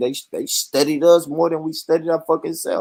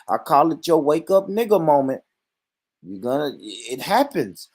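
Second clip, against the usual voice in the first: normal talking voice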